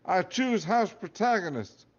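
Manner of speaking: high, loud proud declaration; declaring. Acted. Boy declaring his choice to the hat, loud and bold. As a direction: announce it boldly to the hall. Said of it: "I declared".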